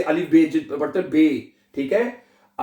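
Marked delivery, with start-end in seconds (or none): none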